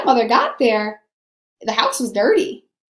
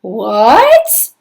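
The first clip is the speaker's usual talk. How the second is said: louder shocked what; shocked cry; 6 dB louder than usual. A narrator's reading. Stepsisters shocked the slipper fits, surprised cry. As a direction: gasp it out in disbelief. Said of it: "my darlings cried".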